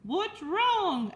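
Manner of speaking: high, ringing public-address call; loudspeaker announcer. Acted. Loudspeaker addressing the fair crowd, grand announcer. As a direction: hail the whole fairground. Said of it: "asked the loudspeaker".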